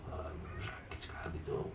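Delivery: hushed, breathy moan of a wounded fighter; whispered pained groan. Acted. Preacher voicing the wounded champion in pain, whispered groan. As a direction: whisper it as if badly hurt.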